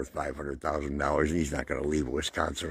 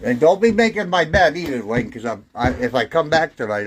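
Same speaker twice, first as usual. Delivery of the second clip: calling out; 8 semitones higher, 7 dB louder than usual. Real, not acted. Calling out to Lynn.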